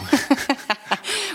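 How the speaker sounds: high burst of laughter; delighted giggle. Real, not acted. Laughing at cookie dough.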